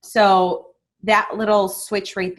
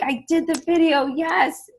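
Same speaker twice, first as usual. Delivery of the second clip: high, bubbly burst of pride; proud, giddy report to a mentor; excited cheer; 10 semitones higher than usual. Retold or imitated. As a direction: squeal it out like you just won. Excitedly messaging Nadia about video.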